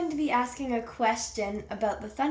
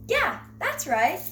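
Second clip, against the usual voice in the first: pleased praise; 8 semitones higher, 3 dB louder than usual. Real, not acted. Praising the right answer.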